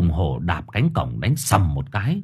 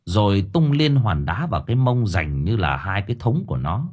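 ordinary delivery

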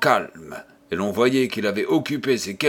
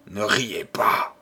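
pleading; 2 semitones lower than usual. A narrator's reading. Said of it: "supplia Matisse".

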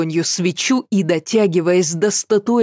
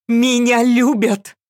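defiant shout; 6 semitones higher than usual. A narrator's reading. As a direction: hurl it at the demon. Girl defying the demon.

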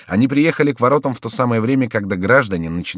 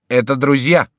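raised, loud announcement; shouted explanation; 4 semitones higher, 5 dB louder than usual. A narrator's reading. Duke to his militia, shouting. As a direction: call it out to stop the fighting. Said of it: "закричал герцог".

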